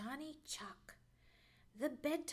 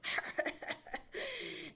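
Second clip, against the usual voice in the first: high, breathy chuckle; acted self-satisfied chuckle; 10 semitones higher than usual. Johnny Chuck as the snake sneaks away, chuckling. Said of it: "Johnny Chuck chuckled".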